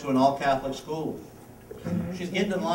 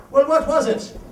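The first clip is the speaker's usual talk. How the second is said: Irish bartender; 10 semitones higher, 7 dB louder than usual. Acted. Bartender pressing, pitch jumps.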